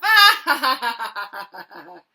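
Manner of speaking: big loud belly laugh; dragon's laugh. Acted. Dragon joining the boy's laughter, loud belly laugh. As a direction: let out a booming laugh. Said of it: "that made Theodore laugh".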